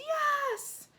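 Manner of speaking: high, screamed yes from a crowd. A narrator's reading. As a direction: shriek it like an excited audience. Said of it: "The crowd all screamed".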